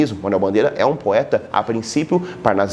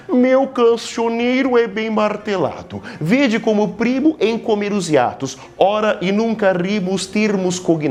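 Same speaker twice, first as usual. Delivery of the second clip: pompous frog poet; 8 semitones higher than usual. Acted. The cooper frog boasting of his verses, higher and pompous. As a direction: declaim like a vain little frog poet. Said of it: "O sapo tanoeiro, parnasiano aguado, diz".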